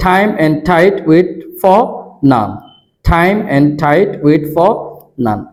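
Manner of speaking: "time" and "tight" with a breathy th, like thaim; aspirated t. Shown as a demonstration. Teacher drilling the aspirated t sound with students. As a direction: hit the t hard with a puff of air. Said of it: "ঠয়ের মতো উচ্চারণ".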